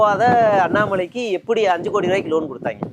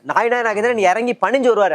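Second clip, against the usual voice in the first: ordinary talk, nothing marked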